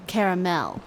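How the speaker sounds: caramel said "kair-uh-MEL"; last-syllable stress. Shown as a demonstration. Streamer comparing ways to say it, final stress. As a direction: let the MEL of caramel ring. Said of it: "I put the emphasis on the last syllable".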